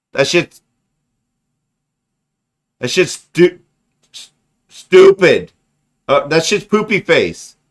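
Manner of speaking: mock-childish, high taunt; childish insult. Grown man insulting like a toddler, high and mocking. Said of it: "you just gotta turn around and be like".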